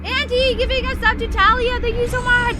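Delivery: high, loud burst of gratitude; gushing, high-pitched shout-out; excited thanks. Real, not acted. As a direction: squeal your thanks to a generous gifter. Thanking a gift sub.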